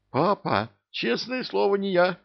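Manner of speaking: high, frightened protest. Acted. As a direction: squeal it out in fright. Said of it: "испугался вор".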